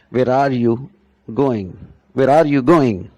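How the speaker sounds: teacher showing stress words; stress on going and where. Shown as a demonstration. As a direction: lean on "where" and "going", let "are you" slip by. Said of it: "our stress is entirely on going or where".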